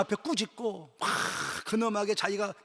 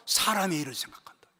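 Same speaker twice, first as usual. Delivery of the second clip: ordinary talk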